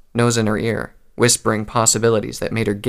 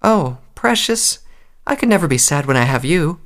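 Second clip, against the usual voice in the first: tender reassuring mother; 6 semitones higher than usual. A narrator's reading. Mother tells her filly she is loved, tenderly reassuring. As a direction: coo it, then gently reassure.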